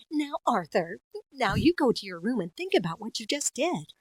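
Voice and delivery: super nice video game mother; Arthur's mom. Acted. Mom sending her child to think, nice explaining voice. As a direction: gently instruct a child in a lilting voice. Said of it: "like, Arthur's mom in a video game".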